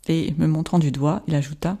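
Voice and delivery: ordinary delivery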